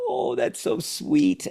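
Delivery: very high, slow tender coo; imitating calmed-down dad. Dad melting after his son says I love you, cooing. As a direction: coo it like a parent melting at a little child. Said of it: "I then calm down".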